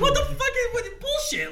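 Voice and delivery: high, loud, laughing outrage; outraged disbelief. Retold or imitated. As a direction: squeal in disbelief. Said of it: "And I'm like".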